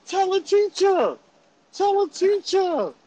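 high, slow, drawn-out call; calling for help. Retold or imitated. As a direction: act out a kid yelling to friends. Stuck kid calling to friends for help.

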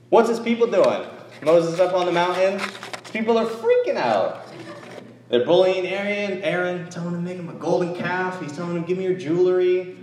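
preacher stirring up the congregation over Israel's golden calf, heated; preaching at full force. Acted. Pastor rebuking the faithless Israelites, fired-up preaching.

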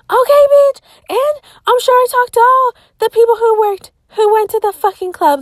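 high, loud exasperated rant; indignant outburst. Real, not acted. Host ranting indignantly about a creepy stranger's message. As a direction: fire it off, loud and offended.

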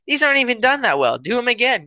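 high, quick mock criticism; hammed-up parental nagging; yelling parent. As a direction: imitate a never-satisfied parent scolding. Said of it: "Why are you yelling at me".

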